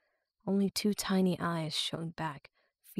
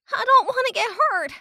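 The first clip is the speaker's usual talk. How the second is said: put-on scared young girl; 9 dB louder than usual. A narrator's reading. Romani voiced as scared girl.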